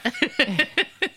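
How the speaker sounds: short rising laugh. Real, not acted. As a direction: giggle at the tease. Laughs again.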